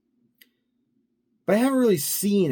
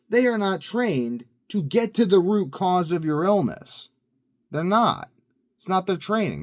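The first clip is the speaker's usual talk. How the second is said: higher, louder insistent lecture; emphatic rant; 10 semitones higher, 7 dB louder than usual. Real, not acted. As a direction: hammer the point home. Ranting about doctors' training.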